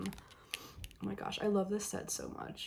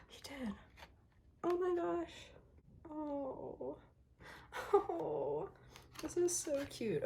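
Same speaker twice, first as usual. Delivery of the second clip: delighted gasp; 7 semitones higher, 5 dB quieter, slower than usual. Real, not acted. Finds the signature.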